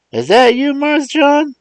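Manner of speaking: put-on high, loud old man's dialect voice; old servant astonished at the talking box; amazed old Uncle Remus. Uncle Remus asks Mars John through the phone, amazed.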